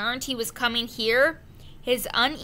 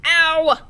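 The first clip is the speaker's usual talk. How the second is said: sudden loud higher yelp; pained yelp; 5 semitones higher, 9 dB louder than usual. A narrator's reading. Boy bashes his elbow in the tiny head, yelping.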